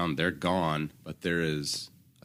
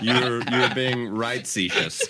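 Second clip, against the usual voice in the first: laughing pun; 5 semitones higher, 5 dB louder, slower than usual. Real, not acted. Wordplay said laughing slowly.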